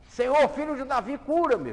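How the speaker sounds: clamor agudo e forte; cego gritando. Acted. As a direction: grita pedindo cura. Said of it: "O cego começou a gritar". (cego Bartimeu clamando a Jesus, gritando)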